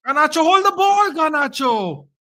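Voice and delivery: high, loud urgent shout; shouting at player. Real, not acted. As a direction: yell at him to keep it.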